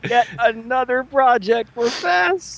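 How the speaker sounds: high, slow dramatic announcer teasing; mock announcer. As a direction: drag it out like a trailer voice.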